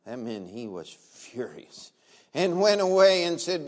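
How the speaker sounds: ordinary talk, nothing marked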